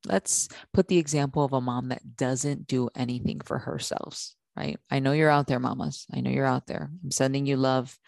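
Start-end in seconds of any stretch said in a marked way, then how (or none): none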